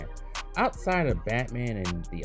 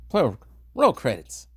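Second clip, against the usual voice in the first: calling out; 5 semitones higher, 7 dB louder, slower than usual. Real, not acted. Calls out sign-off to crew.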